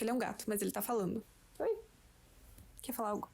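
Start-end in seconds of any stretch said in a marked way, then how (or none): none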